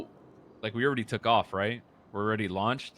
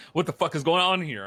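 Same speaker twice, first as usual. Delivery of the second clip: louder, higher retold disbelief; raised-voice exasperation, retelling; baffled outburst; 5 semitones higher, 6 dB louder than usual. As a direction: act out a baffled protest, voice raised.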